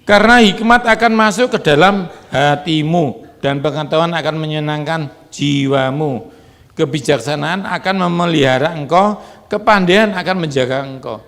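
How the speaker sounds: loud proverb proclaimed to the church; preaching proclamation. Acted. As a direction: declare each line with force. Reads proverb at full force.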